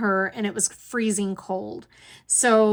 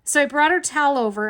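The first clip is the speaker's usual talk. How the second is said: ordinary talk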